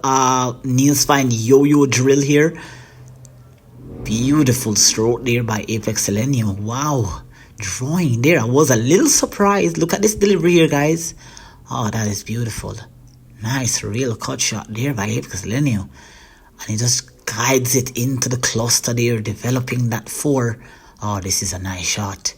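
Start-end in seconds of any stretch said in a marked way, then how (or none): 4.1-20.6 lightly done hushed sports commentary; golf commentator. Acted. Mock commentary on his own match. 21.0-22.3 whispered commentary on the shot; hushed golf commentator. Acted.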